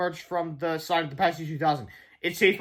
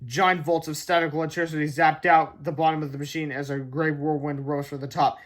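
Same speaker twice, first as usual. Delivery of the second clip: ordinary delivery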